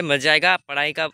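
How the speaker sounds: normal talking voice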